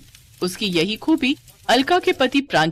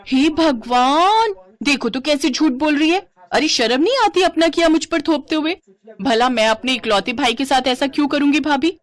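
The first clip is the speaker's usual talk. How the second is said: high, scandalized sister-in-law; mock indignation; 7 semitones higher, 3 dB louder than usual. Sister-in-law indignantly denies the blame. (झूठी नाराज़गी, ऊँचे सुर में)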